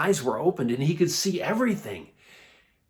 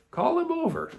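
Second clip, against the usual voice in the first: high-pitched summons; calling out. A narrator's reading. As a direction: call over the heads of a crowd. Jesus calls across the crowd.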